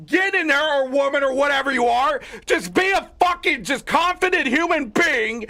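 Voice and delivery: real angry rant; angry shout. Real, not acted. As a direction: yell at them to get in there. Ranting at timid commanders.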